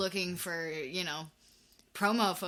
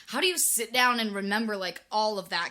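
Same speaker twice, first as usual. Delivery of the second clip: louder, incredulous question; amazed questioning; 3 semitones higher, 7 dB louder than usual. Acted. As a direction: marvel at it in disbelief. Acts out own amazed question.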